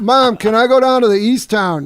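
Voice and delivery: high, loud eager request; calling to Mom. Retold or imitated. Asking Mom to let him go out, eager holler. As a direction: plead loudly with Mom, mock-youthful. Retold asking his mother excitedly.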